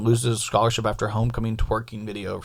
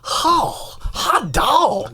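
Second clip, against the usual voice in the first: high excited announcer yelp; hammy baseball announcer cheer; Harry Carey voice; 11 semitones higher, much slower than usual. Pretend. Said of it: "getting way more Harry Carey".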